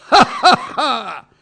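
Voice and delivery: high, loud mocking ha ha ha; burst of laughter. Doctor laughs at the gorilla's boast, loud bursting laugh. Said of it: "Le médecin éclata de rire".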